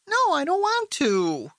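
put-on boy whines at his father's request; whining. A narrator's reading. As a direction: complain like a sulky kid. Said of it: "Sean whined".

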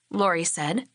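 ordinary delivery, nothing marked

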